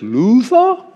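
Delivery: put-on high, rising English-accented call; old English nobleman calling into the room. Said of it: "He didn't say it with an English accent".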